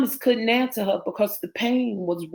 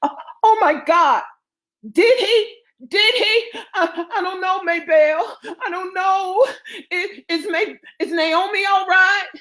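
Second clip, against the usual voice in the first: high, stammering alarm; panicked; 10 semitones higher, 4 dB louder than usual. Acted. Women asking if Naomi is all right, panicked.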